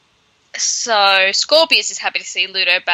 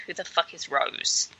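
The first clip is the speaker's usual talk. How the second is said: hushed, almost voiceless mock bafflement; baffled aside; 4 semitones lower, 11 dB quieter than usual. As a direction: mutter it under your breath as a puzzled character. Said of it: "And Hermione's like".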